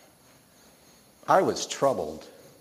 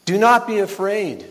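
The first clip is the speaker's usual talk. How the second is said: higher, louder angelic proclamation; bold but comforting; 7 semitones higher, 7 dB louder than usual. Acted. Angel appears to shepherds at night, bold but comforting. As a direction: ring out boldly, yet reassure. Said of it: "her voice all at once bold but comforting".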